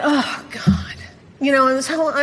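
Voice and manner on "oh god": mock-tortured oh God; a touch of anguished complaint; tortured groan. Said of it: "And I'm like".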